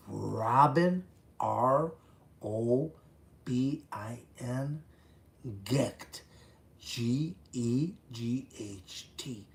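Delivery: slow, emphatic letter-by-letter spelling; measured, punched-out letters. Real, not acted. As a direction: announce the name and spell it deliberately for listeners. Spelling out name for viewers.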